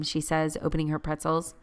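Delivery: usual speaking voice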